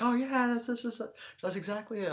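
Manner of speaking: high-pitched, eager imitation of a guest; excited agreement. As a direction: act out someone keenly agreeing. Guest's delighted agreement, retold.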